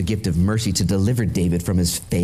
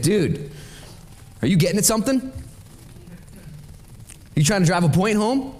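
mildly exasperated questioning, higher toward the end; frustrated; 9 semitones higher than usual. Acted. Peter answering Jesus's repeated question, mildly frustrated. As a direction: push back with mock frustration. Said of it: "Peter gets frustrated at this point".

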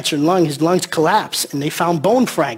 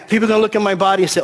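normal talking voice

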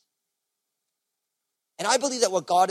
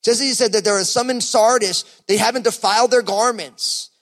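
fervent preaching; 5 semitones higher, 7 dB louder than usual. Acted. Preaching at full force.

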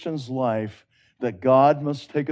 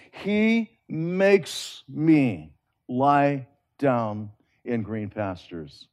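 drawn-out scripture; much slower than usual. Acted. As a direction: linger over every word as if savoring the promise. Preacher repeats the verse, lingering.

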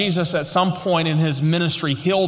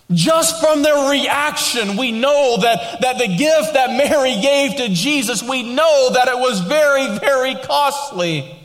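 rising, slow preacher's emphasis; building sermon; 7 semitones higher than usual. Acted. Preacher pressing the congregation on the costly gift, slow and building. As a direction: drive the point home to the congregation.

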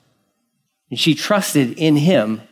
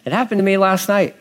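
ordinary delivery

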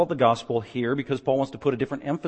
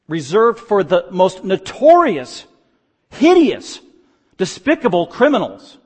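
louder preaching, stretched out on Roman; forceful preaching; 6 semitones higher, 10 dB louder, slower than usual. Acted. Pastor explaining crucifixion to his flock, loud preaching. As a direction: drive home the Roman citizens' pride.

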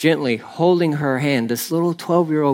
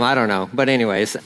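ordinary talk

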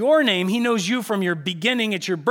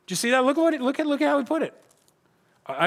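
ordinary talk

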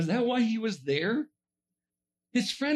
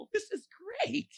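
a laughing, delighted Jesus, soft and high; amused Jesus; 11 semitones higher, 4 dB quieter than usual. Retold or imitated. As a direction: chuckle through it, enjoying the chaos. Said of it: "and Jesus is like".

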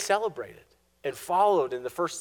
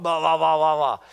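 louder, tossed-off blah blah; dismissive blah; 8 dB louder than usual. Retold or imitated. Preacher brushing off the complaint, loud dismissive babble. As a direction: wave it off mockingly. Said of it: "And that is so sarcastic".

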